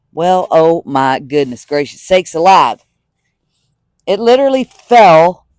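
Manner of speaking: slow startled oh my goodness; surprised. Real, not acted. Clip suddenly falls off.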